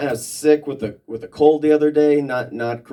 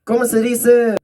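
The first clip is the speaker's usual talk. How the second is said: put-on much higher and louder, an old farmer uncle puzzling aloud; uncle Frank's voice; 11 semitones higher, 6 dB louder than usual. Comic asking his family for a word, in a high loud uncle voice. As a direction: play the chain-smoking uncle reaching for a word, pitched way up. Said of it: "and he'll go".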